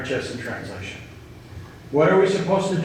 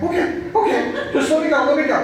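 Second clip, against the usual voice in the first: mock panicked pleading; 10 semitones higher than usual. [name] begging Thane to let him leave, laughing mock panic. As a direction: squeak out a frightened plea, half laughing.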